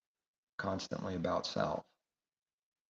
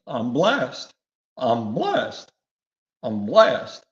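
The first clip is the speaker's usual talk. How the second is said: loud, higher brag of being blessed; mocking boastful star; 4 semitones higher, 12 dB louder, much slower than usual. Preacher mocking LeBron James bragging, loud showy boast.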